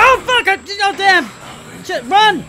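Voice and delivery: startled yell. Real, not acted. Player surprised by a pursuer, startled yell. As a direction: jolt of real fear. Sudden enemy appears.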